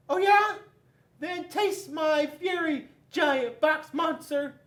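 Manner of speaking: very high, loud battle shout; high furious paper. A narrator's reading. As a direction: squeal with offended fury. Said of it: "Paper took offense to that and said".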